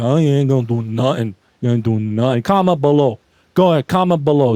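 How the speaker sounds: a hammed-up bully daring them; mock tough guy. Mock threatening challenge to commenters.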